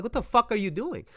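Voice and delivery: high, loud frustrated shout at a teammate; exasperated yelling at player. Retold or imitated. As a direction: yell it like you can't believe what he's doing. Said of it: "I'm like".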